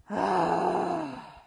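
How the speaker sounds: a long, loud, rising sigh. Shown as a demonstration. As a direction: heave it out. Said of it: "Exhale with a sigh".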